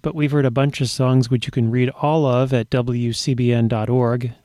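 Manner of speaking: ordinary delivery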